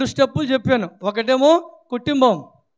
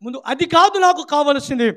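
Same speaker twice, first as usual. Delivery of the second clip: normal talking voice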